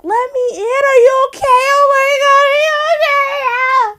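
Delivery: very high, tearful-sounding panic; mock frantic wailing. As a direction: wail through a door, hammed up. Acts frantic calls at the door.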